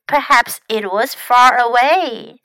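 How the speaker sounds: usual speaking voice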